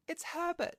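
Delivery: high, loud character voice; excited old woman. A narrator's reading. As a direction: cry out in a high-pitched put-on voice. Old woman's excited cry in story.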